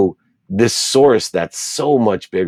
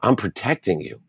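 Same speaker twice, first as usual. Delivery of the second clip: soothing mother; 7 semitones higher than usual. Retold or imitated. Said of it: "And she said".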